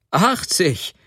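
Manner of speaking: high-pitched cry on a falling tune; amused exclamation. A narrator's reading. Sobotka hearing what his wife paid, amused outcry. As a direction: call it out in amused disbelief. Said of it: "rief Sobotka lachend".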